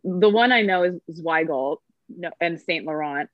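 usual speaking voice

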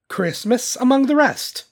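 high, earnest declaration; earnest nephew. A narrator's reading. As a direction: lift the voice in conviction. Nephew defends Christmas.

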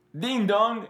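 French names said high and loud; French accent. Retold or imitated. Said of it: "nótese el acento francés".

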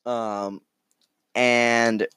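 usual speaking voice

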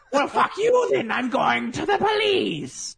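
high, loud indignant leprechaun; angry leprechaun. Pretend. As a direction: snap back in a high leprechaun voice. Rejected leprechaun storms off.

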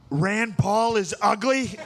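slow, high put-on Trump drawl; a lightly done Trump, deadpan insult; Trump impression. Comic retelling a debate, doing Trump slow and high. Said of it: "figured out kinda how to do his voice".

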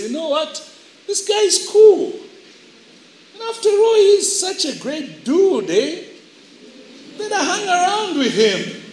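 high, put-on admiring voice; won-over church youngsters. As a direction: gush about a new friend, pitched high. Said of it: "begin to embrace the world and say".

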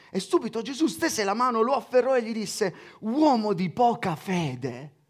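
measured Bible reading voice; scripture reading. Acted. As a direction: read the Gospel out to the congregation. Reads the Gospel aloud.

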